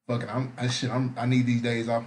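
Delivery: usual speaking voice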